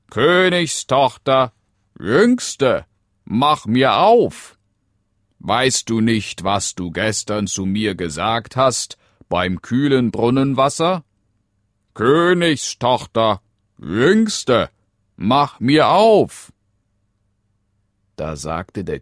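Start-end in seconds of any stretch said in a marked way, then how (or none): 0.1-4.6 high, loud frog call, drawn out at the end. Acted. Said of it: "klopfte es zum zweiten Mal und rief". 5.4-11.0 raised, higher frog voice; insistent frog. Acted. Frog reminds her from outside. 11.9-16.5 high, loud frog call, drawn out at the end. Acted. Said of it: "klopfte es zum zweiten Mal und rief".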